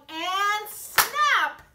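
sudden loud high snap; loud burst. Acted. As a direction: shout it out to startle. Story climax, frog snaps.

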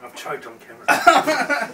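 choked-up laugh through the words, pitched high; laughing while coughing on hot sauce. Real, not acted. To the camera after choking on sauce, laughing.

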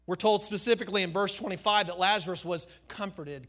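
normal talking voice

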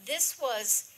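normal talking voice

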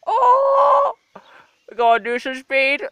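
high, loud cheer; loud delighted encouragement; excited cheering. Real, not acted. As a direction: egg the cat on excitedly. Cheering the cat on.

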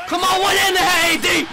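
high-pitched fan yell; urgent shout. Real, not acted. Fan spurring on A D, high urgent shout. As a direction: urge the player on at a yell. Urging on the player.

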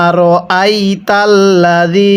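drawn-out Arabic recitation, slow; slow Quran recitation. Shown as a demonstration. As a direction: recite slowly for students to repeat. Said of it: "আমার সাথে পড়ুন।". (ধীরে কুরআন তিলাওয়াত)

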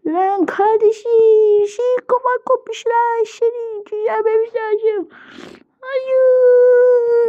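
high, drawn-out mock crying; play-acted wailing. Sibling finding brother's head off, high tearful wail. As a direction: wail over a hurt sibling, hammed-up.

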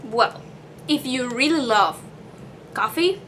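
chewing between words; talking around food; mouth full of chip. Real, not acted. Seen 0: Bit chip at -9; tight, chewing-like mouth while speaking.

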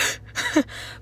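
high breathy disbelieving laugh; incredulous laugh. Real, not acted. Reacting to hearing twenty attachments.

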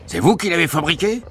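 high, incredulous accusation; accusing. A narrator's reading. Guard suspects father forged the key, accusing shout. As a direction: throw the question up high, accusingly.

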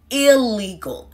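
illegal said slowly, starting with a short "ill"; careful teaching demo of illegal; slow model pronunciation. Shown as a demonstration. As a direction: spell out illegal slowly with the mouth. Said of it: "is how you pronounce the beginning of the word".

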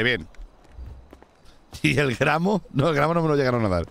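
usual speaking voice

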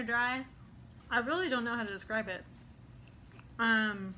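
speaking between bites; talking while chewing. Real, not acted. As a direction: mouth partly full of bread. Seen 0: pops bread piece in mouth at 1.6, chews while talking after.

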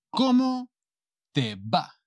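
slow "Cómo... te va", broken by a pause; palabra por palabra. Shown as a demonstration. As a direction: model "Cómo te va" in separate, drawn-out pieces. Teacher models phrase in pieces. (palabra por palabra, despacio y con pausa)

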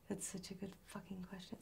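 hushed, voiceless aside; real whisper. Real, not acted. Guest swearing at a tough question then apologising, in a whisper. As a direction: whisper it under your breath.

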